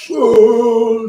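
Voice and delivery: loud, high "oh" sliding downward; imitated falling cry. Little zombie man knocked off the coffee, mock falling wail. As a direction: cry out like a tiny creature tumbling.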